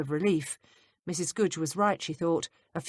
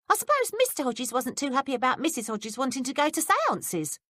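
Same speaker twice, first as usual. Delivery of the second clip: put-on higher, curious young maid; higher maid's voice, prying; 8 semitones higher than usual. A narrator's reading. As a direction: play the nosy young maid, pitched up. Betsy voiced by reader.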